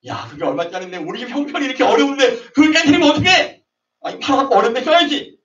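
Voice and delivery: high-pitched indignant scolding; mock complaint. Sister complaining about the broken perfume jar, high indignant voice. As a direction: fuss about the wasted perfume.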